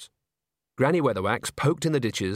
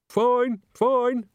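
muffled from behind the bushes; 13 semitones higher, 4 dB louder than usual. Acted. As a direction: talk as if behind a hand. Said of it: "said a muffled voice".

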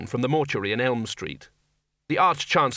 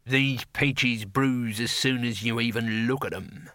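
slow, drawn-out character voice; grumbling trader in a crowd; put-on market bystander; slower than usual. Voice behind the sergeant, slow complaint.

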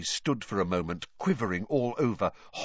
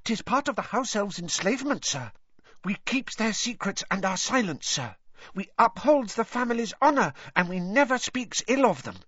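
high elf voice, earnest recital; serious Dobby elf; 10 semitones higher than usual. Acted. Dobby tells Harry of elves' enslavement, high and earnest. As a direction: explain solemnly as a squeaky house elf, faltering at the end.

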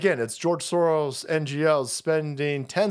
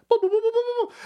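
high booming knock noises, hammed-up; imitated door banging; faster than usual. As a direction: bang on the door with your voice, high and cartoonish. Mock door banging again.

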